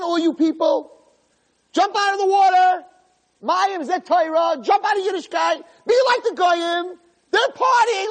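high, shouted urging; goading Satan. Acted. Satan tempts people out of the water, high insistent shouting. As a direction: egg the crowd on in a high holler.

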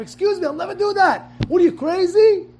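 high, loud indignant rebuke; angry rebuke. Shown as a demonstration. Rabbi showing how to rebuke the Yetzer Hara, loud and indignant. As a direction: slap the tempter down. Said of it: "you get angry at him".

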